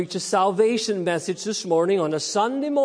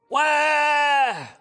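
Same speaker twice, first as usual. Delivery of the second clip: put-on high, loud shouted baby wail; mock hungry baby cry; 8 semitones higher, 5 dB louder than usual. Preacher to children, imitating a hungry baby's cry. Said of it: "'cause he's hungry".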